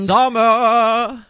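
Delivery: high, loud singing snowman voice; Olaf. Retold or imitated. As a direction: belt it out high like a cartoon song. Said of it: "it literally sounded exactly like Olaf".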